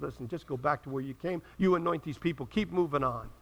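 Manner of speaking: gentle reassurance. Retold or imitated. God quietly sending weary Elijah back, soft and low. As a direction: speak it low and kindly, like a calm father.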